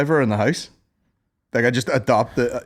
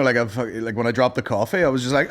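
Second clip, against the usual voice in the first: ordinary talk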